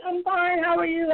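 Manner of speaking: high, light imitation of the dad's polite answer; the dad answers her greeting in a high, mock-polite voice; polite dad reply. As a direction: toss it back pleasantly, lightly voiced. Dad replying in retold exchange.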